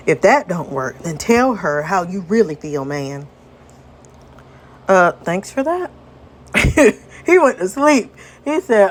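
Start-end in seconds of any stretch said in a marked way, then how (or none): 0.1-3.3 Southern buddy wrapping up his advice; Southern-accented character voice. Acted. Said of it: "I also gave him a Southern accent".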